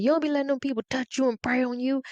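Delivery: a lightly voiced grandfather, fast; hint of an old man warning; grandfather's warning. A grandfather warning his grandchild, lightly voiced and quick.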